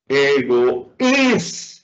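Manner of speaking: Egoísta very slow, drawn out on ÍS; stress on Í. Shown as a demonstration. Teacher showing an example word, slow stress on Í in Egoísta. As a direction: stretch the í of Egoísta, then a separate TA.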